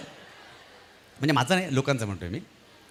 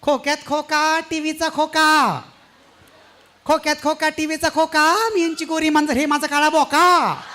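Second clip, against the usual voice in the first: much higher, louder, slow sing-song housewife; housewife reciting ukhana; 12 semitones higher, 7 dB louder than usual. Retold or imitated. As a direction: recite the rhyme like a proud housewife on stage. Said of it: "उखाणा असा होता". (उंच, ठसक्यात उखाणा म्हणणारी गृहिणी)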